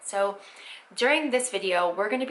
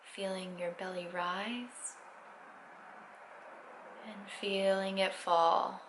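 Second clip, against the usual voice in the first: guided meditation; 6 dB quieter than usual. Acted. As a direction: ease them into stillness, unhurried.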